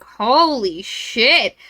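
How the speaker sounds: very slow, drawn-out mock amazement; stunned exclamation. Malcolm seeing the shirtless professor, slowly stunned. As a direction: stretch each word as if stunned.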